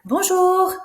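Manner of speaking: very high, loud, quick squeal of hello; different voice. Shown as a demonstration. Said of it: "Saying the words in different voices".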